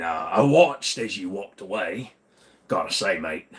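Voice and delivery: a pub-mate Cockney voice that keeps sliding Aussie; Cockney slipping Australian. Shown as a demonstration. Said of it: "Australian, I slip between 'em".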